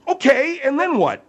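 loud, high, demanding question; impatient prodding. Real, not acted. Host pushing the guest for his point, impatient. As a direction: press him hard to get on with it.

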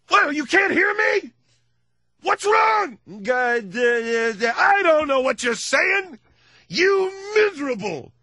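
very high, loud frantic teacher; hammed-up hysterical teacher; shrill screaming teacher. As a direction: scream at a pupil who can't hear. Helen Keller's teacher voiced.

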